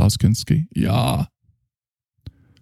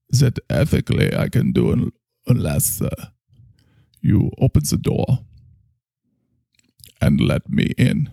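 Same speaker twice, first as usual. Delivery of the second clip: German doctor, probing; 5 semitones higher than usual. Acted. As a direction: speak as a solemn German therapist, pausing often.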